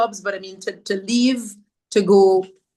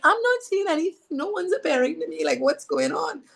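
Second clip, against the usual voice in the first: retelling how she fretted, voice climbing much higher; frustrated high-pitched complaint; 9 semitones higher than usual. Retold or imitated. Mother complaining to her son that no visions come, much higher and exasperated. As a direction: pitch jumps way up, exasperated and whiny.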